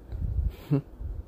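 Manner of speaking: short unvoiced sad laugh; breathy bitter laugh. Real, not acted. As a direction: huff out a hollow laugh. Bitter laugh about abuse.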